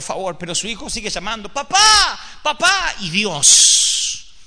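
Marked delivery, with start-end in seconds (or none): 1.5-2.9 put-on child calling out. Isaac keeps calling his father.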